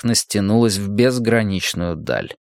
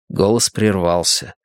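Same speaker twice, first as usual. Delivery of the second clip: ordinary delivery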